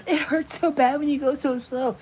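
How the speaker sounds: high, whiny mock suffering; a hammed-up whimpering complaint; mock pained whine. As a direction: ham up the pain of slowness.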